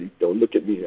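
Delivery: usual speaking voice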